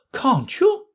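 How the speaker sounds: high, pitying Queen; sorry for the girl. A narrator's reading. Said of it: "in a pitying tone".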